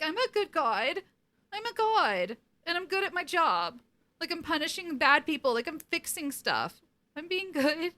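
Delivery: high, self-satisfied mock god voice; smug mock Cass. Recapping Cass at the campaign, high smug mock voice. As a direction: brag like a proud little god. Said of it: "he's just like".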